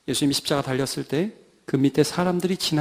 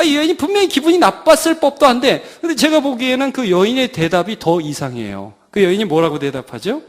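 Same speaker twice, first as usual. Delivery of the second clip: ordinary talk, nothing marked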